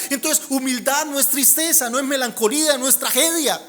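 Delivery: loud, high, rousing preacher voice; joyful preaching. Acted. Pastor rousing the congregation about joy, loud and high. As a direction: lift the room, preach joy at full force.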